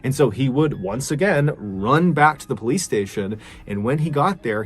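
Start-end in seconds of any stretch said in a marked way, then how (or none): none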